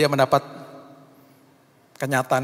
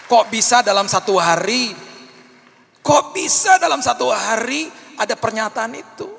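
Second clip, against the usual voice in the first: loud high marvelling outcry; amazed exclamation; 10 semitones higher, 8 dB louder than usual. Acted. Preacher marvelling at the miracle before the church, loud high amazement.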